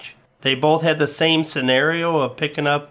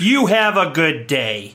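slow, louder, pointed; slow pointed farewell; 3 semitones higher, 4 dB louder, slower than usual. Acted. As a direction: drag it out, curtly. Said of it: "repeated slower".

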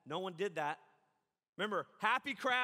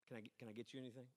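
hushed offer; 9 semitones lower, 15 dB quieter, much faster than usual. Retold or imitated. Visitor whispering in mourning house.

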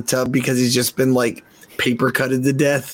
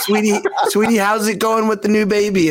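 mock sweet cashier; 7 semitones higher than usual. Imitating the cashier's sugary tone.

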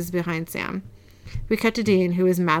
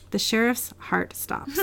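slow, softer "The sheriff's heart stops."; careful correction; 3 semitones higher, 5 dB quieter than usual. Real, not acted. Corrects the flubbed line.

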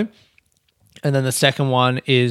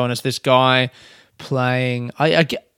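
ordinary talk

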